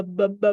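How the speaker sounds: repeated short b, b; b sound. Shown as a demonstration. Teacher modeling the starting sound for the child. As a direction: tap out the b sound in b, b. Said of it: "starts with the b".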